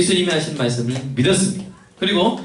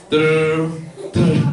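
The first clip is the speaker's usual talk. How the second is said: trilled mock ringtone; mouth-made phone ring; imitated phone ringing. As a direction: roll the tongue like a dial tone.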